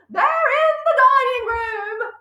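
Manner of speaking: high, slow scared hiss; frightened hiss. Acted. Mother fearing burglars, hissing to her husband. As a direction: hiss it in fear. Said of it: "hissed the mother".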